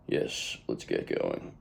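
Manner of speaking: whisper. Real, not acted. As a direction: drop to a hushed whisper to kick things off. Hushed aside before starting.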